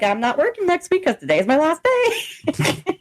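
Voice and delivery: high-pitched indignant protest; retold fed-up objection; exasperated retort. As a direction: spell it out in rising disbelief. Retelling her exasperated reply.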